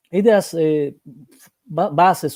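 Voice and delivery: normal talking voice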